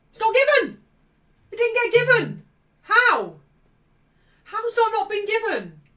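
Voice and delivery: high, loud incredulous yelling; real high-pitched indignant shout; outraged disbelief. Real, not acted. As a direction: cry out at a shocking refereeing decision. Outraged that penalty is refused.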